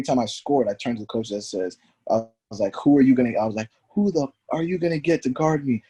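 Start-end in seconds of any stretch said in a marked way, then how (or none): 2.8-3.4 imitating a heated challenge, louder; heated taunt. Retold taunting of coach. 3.9-5.8 retold trash talk, pitched high; heated taunt.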